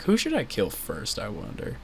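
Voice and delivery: soft sinister musing; creepy murmur. Retold or imitated. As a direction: muse it quietly, creepy. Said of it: "he's like".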